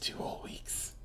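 soft dramatic whisper. Pretend. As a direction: hush it like a secret. Echoing the deadline dramatically.

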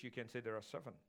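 hushed playful aside to the congregation; quiet joking aside. Shown as a demonstration. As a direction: slip it in as a quiet joke. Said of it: "If you wish".

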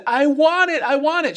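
put-on high, loud whiny kid; hammed-up tantrum whine, high and loud; whining child. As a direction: demand it like a stubborn little boy. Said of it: "And he said".

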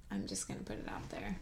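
soft unvoiced aside; whispering. Real, not acted. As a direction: whisper it as a confession.

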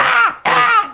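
high screechy kitten cries; short piercing mock cat shrieks; screaming kittens. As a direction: imitate tiny kittens screaming. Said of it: "like, screaming, going".

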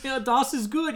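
a hammed-up fake German accent from a Midwesterner; bad German accent. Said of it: "trying to be like".

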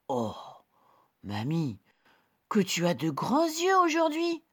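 put-on high, bright little girl. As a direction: play the small girl. Girl questions granny.

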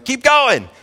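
high, loud cheering-on; yelled encouragement. Acted. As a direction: yell it like a parent coaching a kid on a bike. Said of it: "you're just yelling".

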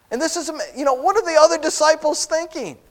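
ordinary delivery

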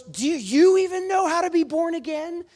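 loud, very high mock-furious question; high, loud imitated outrage; retold angry outburst. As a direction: act out yelling in disbelief. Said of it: "atheist doctor laying on the bed is angry".

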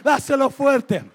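loud, driving sermon shout; preaching at full force. Acted. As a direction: preach it hard to the crowd.

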